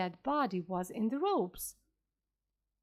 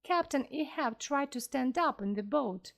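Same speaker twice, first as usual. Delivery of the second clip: ordinary delivery, nothing marked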